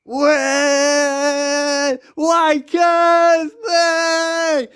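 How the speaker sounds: high, loud whooping cheer; wild celebratory yelling; ecstatic shouting. Retold or imitated. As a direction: whoop it up on the rooftop. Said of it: "and we're like".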